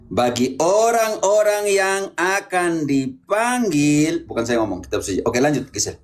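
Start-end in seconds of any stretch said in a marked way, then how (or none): 0.1-4.3 very slow, elongated syllables; drawn-out, deliberate exposition; emphatic preaching. Real, not acted. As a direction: stretch each word out like a preacher driving it home. Priest expounds verse emphatically.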